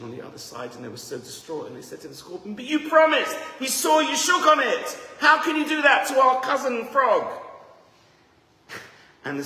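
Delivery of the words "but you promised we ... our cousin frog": high, loud outraged cry; outraged family. Acted. Frog's family yelling at the scorpion, high and outraged. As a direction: shout your betrayal at the scorpion.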